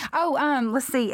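ordinary talk, nothing marked

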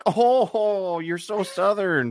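high, drawn-out cooing imitation; teasing relatives. Maine family teasing his Southern talk, high mocking coo. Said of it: "And they're like".

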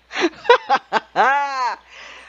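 a real high laugh breaking out. Real, not acted. Laughing at the memory.

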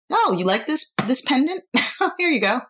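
a light, laughing take on mom offering the pendant; mother giving it away. Retold or imitated. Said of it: "She'll pretty much give it to them".

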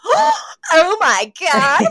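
high, drawn-out hammed-up gasp; starstruck. Fiance recognising Maxwell Sheffield, hammed-up starstruck gasp. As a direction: gush in high-pitched mock awe.

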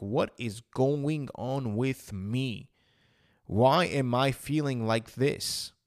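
very slow, drawn-out disbelief; slow, weighted question to oneself; bewildered self-questioning. Retold or imitated. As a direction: stretch each word in retold bewilderment.

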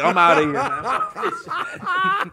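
laughing quote. Retold or imitated. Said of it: "I'm like".

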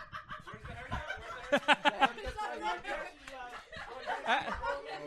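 helpless, drawn-out laughter; long laughing fit. Real, not acted. Host cracking up with the group, long laugh. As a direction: keep laughing as it tails off.

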